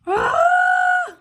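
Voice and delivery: sudden high yelp of alarm; high startled squeal; startled shriek. Real, not acted. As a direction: yelp in surprise at own mistake. Startled cry at mistake.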